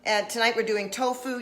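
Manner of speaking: ordinary talk, nothing marked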